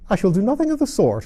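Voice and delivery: high, loud, quick huffy refusal; indignant mouse refusing, fast; offended refusal. A narrator's reading. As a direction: huff and storm off.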